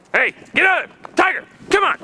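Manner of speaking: very high urgent shout; calling the dog. Real, not acted. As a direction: yell at the dog to come. Calling dog away from ice.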